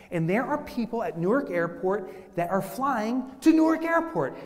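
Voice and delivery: ordinary talk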